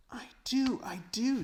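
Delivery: put-on high, eager little-girl voice; Lucy bursting with delight; a young girl's thrilled, high-pitched I do; excited girl. Lucy's excited reply.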